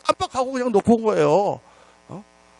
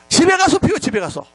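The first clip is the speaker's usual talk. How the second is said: high-pitched burst of scolding, dropping off on the repeat; retold scolding; 9 semitones higher than usual. Pastor scolding smokers at church, retold high-pitched scolding. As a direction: scold them as if retelling your own outburst. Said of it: "막, 막 그렇게 야단쳤어요".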